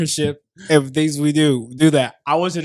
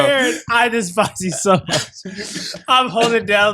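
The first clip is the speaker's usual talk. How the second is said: higher-pitched, half-choked protest; choking; 6 semitones higher than usual. Real, not acted. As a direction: protest while fighting back a choke. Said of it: "I was really choking down".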